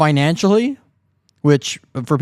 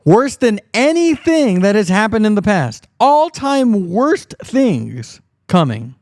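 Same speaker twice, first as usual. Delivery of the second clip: mock preacher; 6 semitones higher, slower than usual. Echoing the preacher's clip mockingly.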